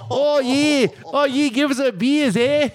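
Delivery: high, hammed-up Kiwi twang; bad New Zealand accent. Re-enacting drunken mimicry of the waitress, terrible New Zealand accent. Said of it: "put on all of a sudden a New Zealand accent".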